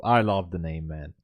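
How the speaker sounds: usual speaking voice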